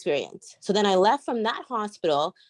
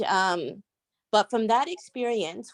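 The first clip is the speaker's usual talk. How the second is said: ordinary talk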